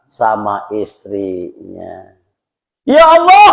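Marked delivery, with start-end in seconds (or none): 2.9-3.5 high, loud cry of protest; acted outraged plea, pitched way up; crying out to God. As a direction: call out sharply to God in complaint. Wife's acted complaint to God.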